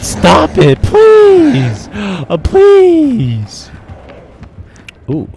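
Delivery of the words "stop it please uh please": high, loud desperate pleading; begging shout. Real, not acted. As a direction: beg the killer at the top of your voice. Begging the killer.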